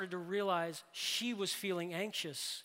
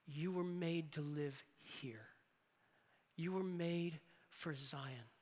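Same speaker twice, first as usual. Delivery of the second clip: slow, softer, breathy appeal; soft near-whisper; 4 dB quieter, slower than usual. Acted. Preacher speaking tenderly to sons and daughters, hushed. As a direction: lean in close and say it gently.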